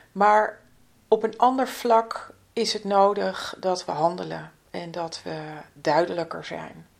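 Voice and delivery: usual speaking voice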